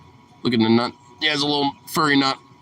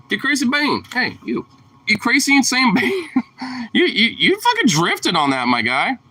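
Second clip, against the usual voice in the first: excited hype at dog; 11 semitones higher than usual. Real, not acted. Hyped calling dog's name.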